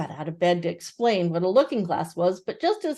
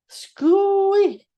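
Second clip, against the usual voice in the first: high loud garbled mumble; mouth full; 12 semitones higher, 9 dB louder than usual. Acted. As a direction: spit it out through food.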